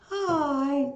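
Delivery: a hint of a shy manager's hi; imitating a cute hi. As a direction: copy her sweet little greeting. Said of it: "That's how she said it".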